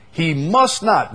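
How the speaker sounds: He "must not" drawn out and slow, "must" punched; stress on must not. Real, not acted. Preacher to his class, hammering the command, very emphatic. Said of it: "very emphatic".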